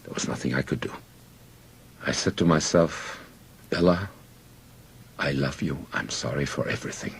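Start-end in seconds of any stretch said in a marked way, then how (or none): 3.7-7.1 very soft, hushed goodbye; tender farewell. A narrator's reading. Man facing death, to his beloved, hushed farewell. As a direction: say it softly, with pauses, to yourself.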